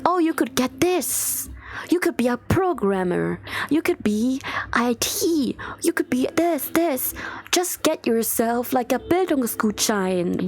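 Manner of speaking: high, slow sing-song ad pitch; sarcastic imitation of a job advert; mocking ad voice. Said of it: "advertisements going like".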